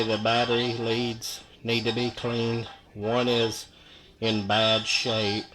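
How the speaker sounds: ordinary talk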